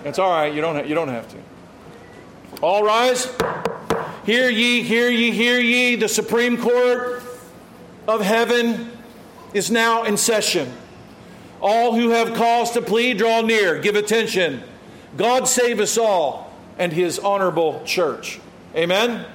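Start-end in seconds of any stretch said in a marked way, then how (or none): 2.6-3.3 formal bailiff's call, rising end. Shown as a demonstration. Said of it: "I took these words from". 4.3-16.5 town crier. Shown as a demonstration. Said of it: "I took these words from a transcript".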